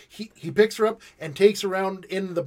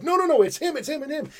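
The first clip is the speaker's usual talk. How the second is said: panicked denial; 10 semitones higher than usual. Retold or imitated. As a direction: blurt it out, desperate to shift the blame. Panicked suspect blaming others.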